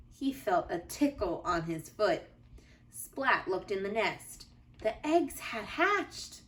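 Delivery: put-on gentle, lively read-aloud for children; cozy storybook narration for little listeners; bedtime story reading. A narrator's reading. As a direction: read it like a bedtime picture book.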